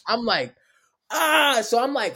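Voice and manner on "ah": high "ah" of someone just maced; mock pained cry. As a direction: cry out as if sprayed in the face. Re-enacts being maced in the face.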